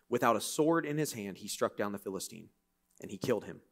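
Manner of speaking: quiet, measured reading of the passage; scripture reading. Acted. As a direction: read the climax softly. Reads scripture aloud.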